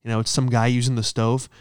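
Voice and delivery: ordinary talk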